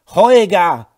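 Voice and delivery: loud furious yell of his name; angry shout. A narrator's reading. Laura yells angrily at her passed-out husband. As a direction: shout it, losing patience. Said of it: "Ihr Ton wurde lauter und wütend".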